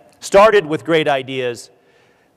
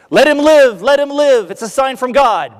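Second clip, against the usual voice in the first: much higher, louder excited cry; crowd shouting; 10 semitones higher, 4 dB louder than usual. Retold or imitated. Speaker voicing the stunned crowd begging to spare the priest, high excited shout. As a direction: cry out as if witnessing a miracle.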